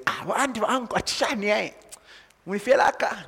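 put-on high, lilting Bemba greeting from a child; village kid greeting. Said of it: "you wouldn't necessarily expect the kid to say".

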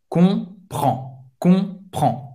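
"Comprend" ending on a nasal vowel, D unsounded. Shown as a demonstration. Teacher repeating for learners, "Comprend" with a nasal ending. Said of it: "tem um som bem nasal".

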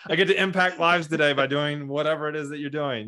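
upbeat, through a laugh; laughing mock pep talk. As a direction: sell the silver lining, half laughing. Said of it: "being like".

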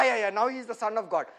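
ordinary delivery

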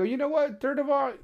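retold high-pitched telling-off of the customer; heated comeback. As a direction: go high and cut him off. Retold confrontation with rude customer.

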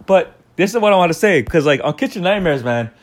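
ordinary delivery

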